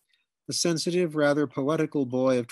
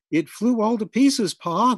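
high, loud excited description; vivid telling; 9 semitones higher, 8 dB louder than usual. A narrator's reading. Mother describing the wreck to grandfather, high and loud. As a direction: paint the crash with big animated energy. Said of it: "in graphically describing the accident".

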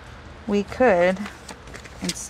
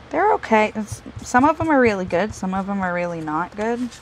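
ordinary talk

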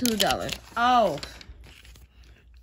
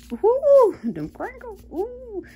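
high squeal of disgust; squealing at crinkles; 12 semitones higher, 4 dB louder, slower than usual. Real, not acted. Viewer shown bandage crinkles, high yelp.

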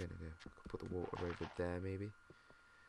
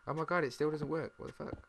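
high, loud exasperated cry at the broken build; frustrated outburst; 7 semitones higher, 6 dB louder than usual. Real, not acted. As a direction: throw up your hands, it still fails. Game build keeps failing.

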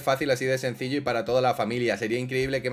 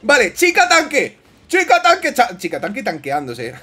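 excited laughing; 9 dB louder than usual. Real, not acted. Laughing at tank girl.

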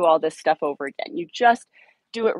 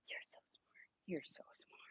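whispered praise; 26 dB quieter than usual. Real, not acted. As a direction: gasp then whisper praise.

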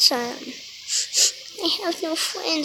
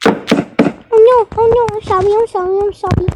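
high, loud, tumbling sorries; panicked apology; 7 semitones higher, 6 dB louder, faster than usual. Pretend. Student panicking after a mistake, frantic apology.

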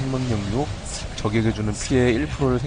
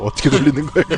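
laughing question; 4 semitones higher, 7 dB louder than usual. Real, not acted. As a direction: giggle through a polite question. Laughing at username.

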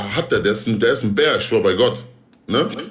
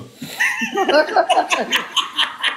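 cracks up laughing; high burst of laughter. Real, not acted. Laughs at own story.

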